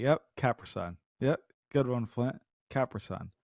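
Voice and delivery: humouring a friend under breath; quietly humouring. Retold or imitated. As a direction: mutter along to humour him.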